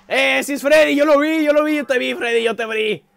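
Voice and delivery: high, loud yelling at the monster; excited alarmed shouting. Real, not acted. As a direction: shout in panicked recognition. Shouting at Freddy who appeared.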